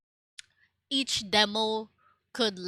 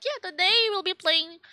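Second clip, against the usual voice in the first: talking through hiccups; words jolted by a hiccup; 9 semitones higher, 4 dB louder than usual. Real, not acted. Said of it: "Sorry, I'm, like, hiccuping".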